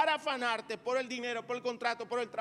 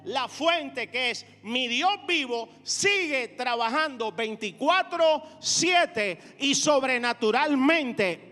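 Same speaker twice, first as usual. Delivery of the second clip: emphatic, driving sermon delivery; rousing preacher, drawing out big phrases; fervent preaching; 6 dB louder than usual. Real, not acted. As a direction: preach it out, leaning on the key words. Preaches at full force.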